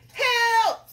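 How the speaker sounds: high, loud cartoon cry; frightened cook. Cook is asked to catch fish, hammed-up fright. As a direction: yelp it in hammed-up panic.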